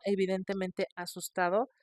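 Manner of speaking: normal talking voice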